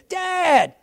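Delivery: put-on high, happy child's call; kids calling dad. Children greeting dad at the door, high call.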